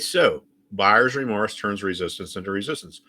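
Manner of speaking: ordinary delivery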